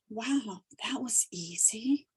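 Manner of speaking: breathy, hushed wow of disbelief; amazed whisper. Retold or imitated. Finding her old love online so fast, a stunned whispered wow.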